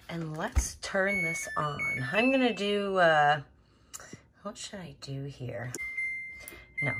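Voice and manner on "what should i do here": very soft, lower, thinking aloud; whispered musing. Real, not acted. Vlogger choosing a washing machine setting, whispered musing. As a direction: half-whisper to yourself.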